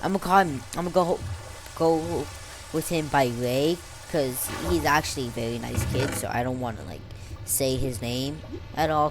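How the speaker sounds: ordinary delivery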